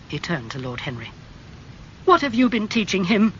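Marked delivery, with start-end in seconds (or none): 2.0-3.3 louder, higher demand; angry. A narrator's reading. Basil confronting Lord Henry, angry. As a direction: challenge him sharply. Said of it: "he asked angrily".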